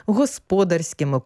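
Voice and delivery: ordinary talk, nothing marked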